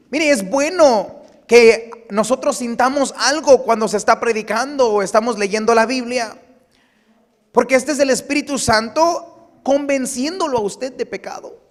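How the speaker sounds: predicando fuerte y lento, con fuego; predicación enérgica. Acted. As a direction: empuja cada palabra a la congregación. Predica a plena fuerza. (predicación a todo pulmón)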